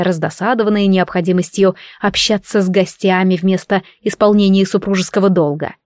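ordinary delivery

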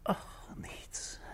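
unvoiced, fading whisper; whispered retreat. Husband dropping his question to the inspector, whispered. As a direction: back away from the question under your breath. Man backs off again.

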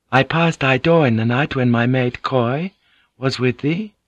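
Mariko, explaining. Acted. Narrator voices Mariko in dialogue.

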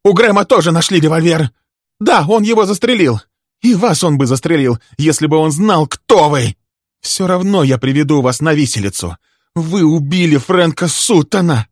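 high, loud tirade; enraged woman railing at her enemy; furious shouting. A narrator's reading. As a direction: hurl accusations at full voice. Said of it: "яростно крикнула Милли".

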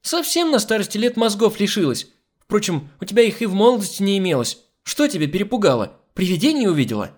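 high-pitched, exasperated telling-off; scolding. Acted. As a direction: berate the foolish maid. Hostess keeps scolding the maid. (раздражённая брань высоким голосом)

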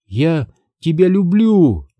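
a hint of crying in a confession; strange tearful voice. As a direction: say it slowly through faint tears. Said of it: "всё тем же странным голосом сказала она".

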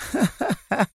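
high, soft evil cackle; a sneering villainess laugh; devilish mocking laugh. A narrator's reading. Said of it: "أطلقت ضحكة شيطانية ساخرة". (ضحكة شيطانية ساخرة)

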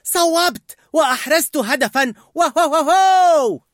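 high, loud goal celebration; celebrating shout. A narrator's reading. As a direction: shout in triumph, ending in a long whoop. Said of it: "ثم صاحت محتفلة".

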